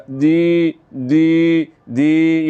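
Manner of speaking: دی with the vowel held long. Shown as a demonstration. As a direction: stretch the ee in دی. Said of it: "اس کو لمبا کرنے سے ہی دی پڑھا جائے گا".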